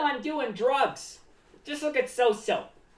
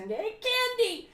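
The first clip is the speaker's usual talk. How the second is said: much higher and slow, "candy" drawn out; excited kid; 10 semitones higher, slower than usual. Retold or imitated. Kid bursting into candy store, excited squeal. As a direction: squeal like a kid spotting sweets. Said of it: "you know, so, so, so".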